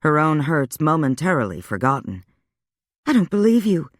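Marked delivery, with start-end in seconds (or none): 3.1-3.9 higher, startled disbelief; surprised disbelief. A narrator's reading. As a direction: catch her off guard. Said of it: "She looked up in surprise".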